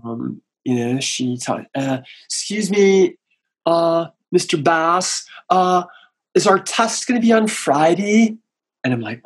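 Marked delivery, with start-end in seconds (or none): 2.2-8.3 higher, put-on schoolgirl voice, slow on the name; mimicking a classmate. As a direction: tease her by copying her voice. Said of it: "I would mimic her".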